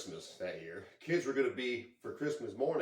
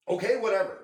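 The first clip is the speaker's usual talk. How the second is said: exasperated dismissal; 8 semitones higher, 7 dB louder than usual. Retold or imitated. Giving in after being refused painkillers.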